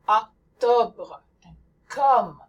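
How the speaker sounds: Octobre and comme with an open French o. Shown as a demonstration. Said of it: "There's the O as in".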